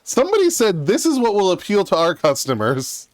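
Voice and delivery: hammed-up executive pitch; mock corporate exec. Friend mocking a company decision, mock exec voice. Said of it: "I'm like".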